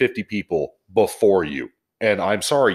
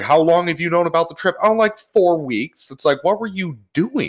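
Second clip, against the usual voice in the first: raised, exasperated questioning of a customer; mock exchange; 9 semitones higher than usual. Advisor replaying a customer exchange, high and incredulous. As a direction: act out both sides, high and incredulous.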